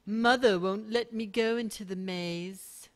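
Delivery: gently mocking British child voice; mock British kid. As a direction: read the kid's line in a British accent, slowly. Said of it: "It sounds like you have a British accent".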